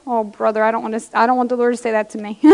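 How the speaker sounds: soft, quick muttered aside to herself; quiet aside. Real, not acted. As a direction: drop the voice and rush it like a private confession. Quiet self-aside mid-sermon.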